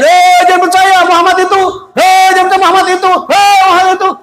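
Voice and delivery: very high, rapid heckling shout; yelling. Acted. Blind man being fed warns everyone about Muhammad, yelling. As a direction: yell it high and fast, over and over. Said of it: "Dia teriak-teriak lagi".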